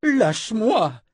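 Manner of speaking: high, slow strained cry to be let go; high, strained, drawn-out plea; choked cry. Acted. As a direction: force it out while being choked. Sister being choked.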